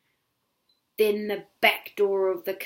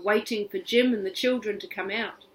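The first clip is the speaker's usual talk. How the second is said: usual speaking voice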